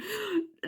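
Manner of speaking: sharp high gasp of mock horror; a quick outraged intake of breath; shocked gasp. Real, not acted. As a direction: gasp as if at something sacrilegious. Outrage at scotch on haggis.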